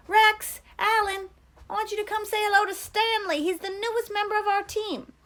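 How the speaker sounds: high, loud call across the yard. A narrator's reading. Counselor calling to two boys across the compound, loud high call. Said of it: "Mister Pendanski called to them".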